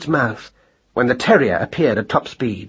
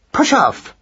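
much higher, sharp dismissal; angry; 12 semitones higher, 4 dB louder than usual. A narrator's reading. Mouse wife orders husband away, angry. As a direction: send him packing crossly, pitched high. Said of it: "said Madeline angrily".

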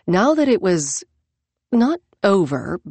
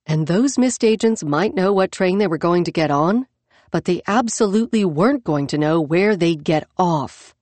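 ordinary delivery, nothing marked

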